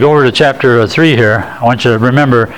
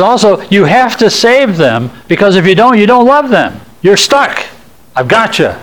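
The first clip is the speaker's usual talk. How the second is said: taunting Satan; 7 semitones higher than usual. Retold or imitated. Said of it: "he said".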